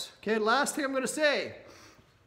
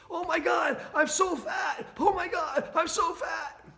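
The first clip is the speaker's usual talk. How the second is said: mock panicked wail; 6 semitones higher, slower than usual. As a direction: cry out like someone panicking over their weight. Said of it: "you keep having that thought".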